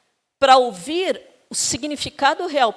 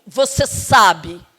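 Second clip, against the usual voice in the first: slow, pointed, louder "você sabe"; curt sulky reply; 3 semitones lower, 8 dB louder, much slower than usual. Retold or imitated. Partner refusing to say why angry, slow pointed brush-off. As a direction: drop it heavily like a cold brush-off.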